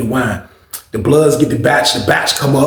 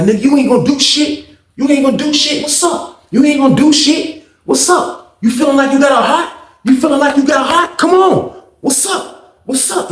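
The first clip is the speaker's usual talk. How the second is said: mock challenge; 11 semitones higher than usual. Bully challenging to fight.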